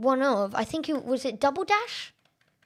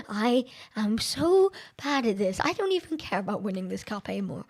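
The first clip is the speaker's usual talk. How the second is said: ordinary talk, nothing marked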